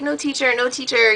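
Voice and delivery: a hint of a whiny child pleading; begging kid. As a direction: lightly mimic a kid begging the teacher. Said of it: "of course kids beg".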